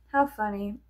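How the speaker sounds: usual speaking voice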